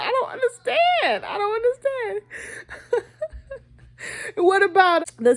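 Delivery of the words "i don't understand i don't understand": high-pitched, laughing plaintive protest; hammed-up high whine; mock bewildered child. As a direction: play a confused kid protesting, half laughing. Acting a tearful, confused child.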